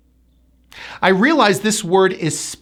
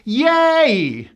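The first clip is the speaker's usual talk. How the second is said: higher, drawn-out mock cheer; pretend lottery cheer; 5 semitones higher than usual. Teacher acts out winning the lottery, pretend cheer. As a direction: play at cheering a win. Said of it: "hit the lottery and win".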